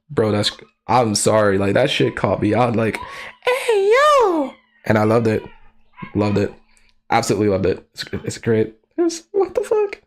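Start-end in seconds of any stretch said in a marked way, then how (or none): 3.4-4.5 high squeaky call; playful hey yo. Retold or imitated. Quoting a line, high voice. 9.0-10.0 high squeaky disbelief; shocked what the fuck. Real, not acted. Disbelief at scene.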